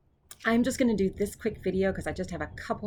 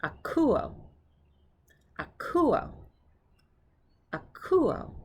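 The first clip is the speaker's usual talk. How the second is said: "Akuo" said more slowly, high on the middle syllable; careful, slower "Akuo" with pauses between; slowed down; 8 semitones higher, much slower than usual. Shown as a demonstration. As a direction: draw out "Akuo" for learners. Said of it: "I'll slow it down".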